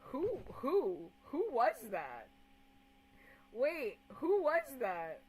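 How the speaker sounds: loud, insistent questioning; urgent demand. Real, not acted. Streamer demanding to know who got blocked, loud and insistent. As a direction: push each question out loudly.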